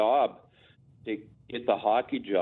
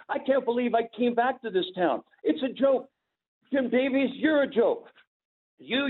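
high-pitched wrestling heel rant, imitated; Micah Singh; 8 semitones higher than usual. Wrestler grabbing the interviewer's mic, hammed-up high-pitched angry tirade. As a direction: rant like a villain wrestler hating the whole town. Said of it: "I'm with Micah Singh right now".

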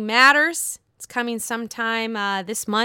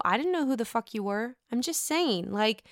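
usual speaking voice

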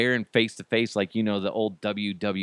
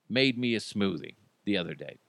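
ordinary talk

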